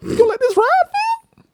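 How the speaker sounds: high-pitched mocking voice; squeaky high voice. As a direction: talk it up in a squeaky voice. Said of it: "you be like".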